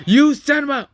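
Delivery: high, loud outraged yell; sudden furious cry in character; angry scream. Acted. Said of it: "she gets angry and screams at you".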